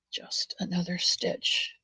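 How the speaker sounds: soft slow whisper of the phrase. Real, not acted. To herself while knitting, a slow whisper. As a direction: breathe it out, slow and hushed.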